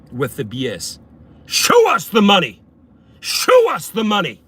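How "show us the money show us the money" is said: loud, high chanted demand; punchy shout, leaning on the first word; rallying shout. Acted. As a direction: holler it like a protest slogan. Rallying viewers against the companies.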